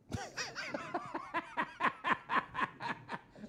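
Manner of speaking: high, breathless burst of laughter; high breathy laugh. Real, not acted. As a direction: laugh hard and high, nearly silent. Cracking up at toddler story.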